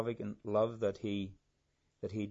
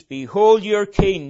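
loud, slow proclamation leaning on "your"; stress on your; 8 semitones higher, 15 dB louder, slower than usual. Shown as a demonstration. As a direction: hit "your" hard, high and drawn out. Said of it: "the emphasis being on your".

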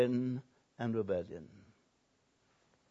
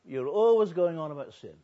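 higher, lightly voiced huffy churchgoer; indignant woman; 5 semitones higher, 4 dB louder than usual. Woman scolding the pastor about his preaching, mock indignant. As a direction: sniff at the pastor in offended protest. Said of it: "she told me".

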